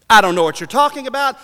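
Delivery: high, quick, forceful denial; intensified denial. Acted. Peter keeping pace with his accusers, forceful denial. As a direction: deny it hard and fast. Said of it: "so does the nature of the denials".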